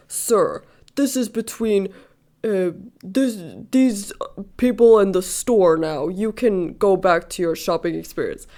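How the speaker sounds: loud, put-on stern employee voice; pretend mad. Store employee sends the angry man away, pretend mad. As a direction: play the clerk faking authority, firm and halting. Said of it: "he pretends to, like, get mad at me".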